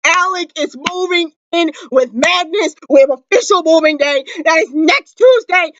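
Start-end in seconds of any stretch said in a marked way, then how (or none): none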